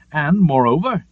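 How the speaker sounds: usual speaking voice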